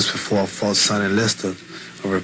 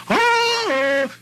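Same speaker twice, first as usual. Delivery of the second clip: high exclamation. Real, not acted.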